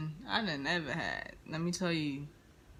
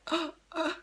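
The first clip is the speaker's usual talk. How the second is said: stunned stammer; 13 semitones higher, slower than usual. Retold or imitated. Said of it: "And I'm just like".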